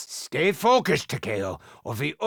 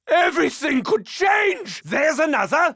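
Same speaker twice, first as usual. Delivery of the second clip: 1 alarmed shout; 6 semitones higher than usual. Acted. Combat alarm at enemy.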